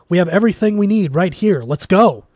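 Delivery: loud, fast, panicked urging; a panicky scientist yelling to leave; frantic shout. A narrator's reading. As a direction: hurry them out, shouting in panic. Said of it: "waved it frantically".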